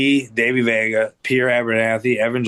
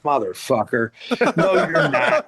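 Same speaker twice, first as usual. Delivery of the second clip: incredulous retort. Retold or imitated. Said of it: "I'm like".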